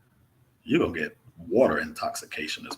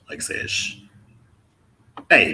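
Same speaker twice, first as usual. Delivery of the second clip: normal talking voice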